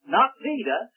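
ordinary talk

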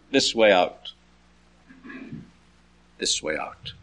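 fading echo. Acted. Jesus' call echoing round the tomb, low and fading.

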